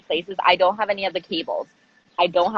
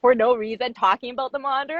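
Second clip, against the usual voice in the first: ordinary talk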